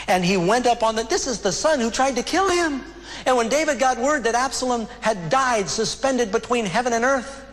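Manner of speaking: high-pitched, urgent pulpit voice; impassioned preaching. Real, not acted. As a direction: preach it with rising fervor. Preaching at raised intensity.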